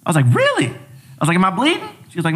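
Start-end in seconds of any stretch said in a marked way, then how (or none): 0.3-0.7 much higher, incredulous question; retold disbelief. Patient told no visible broken bones, high disbelief. Retold reaction to medic's answer. 1.4-1.9 retold incredulous question. Injured patient asking the medic, high puzzled question.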